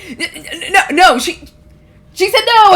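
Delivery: stammering, flustered high voice; shaky, panicked protest. Acted. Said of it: "like, shaking. He's just like".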